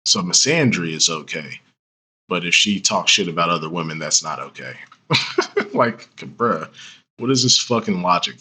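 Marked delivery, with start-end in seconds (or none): 5.1-5.7 high, breathless scoff of a laugh; short high unvoiced snicker; scornful laugh. Real, not acted. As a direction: laugh at the logic in disbelief. Mocking the argument's logic.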